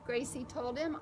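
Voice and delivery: plain reading voice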